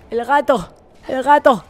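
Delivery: loud and urgent; alarmed shout. Real, not acted. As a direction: call it out in alarm. Panicking during a gunfight.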